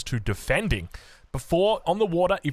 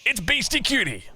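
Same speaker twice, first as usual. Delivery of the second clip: esports announcer; 5 semitones higher than usual. Acted. Caster presenting the players to viewers, announcer voice.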